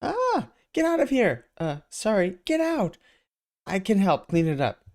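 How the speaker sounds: high falsetto woman shouting; startled woman in falsetto; yelling woman. Acted. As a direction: shriek at the intruder, then apologise. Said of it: "a lady yells".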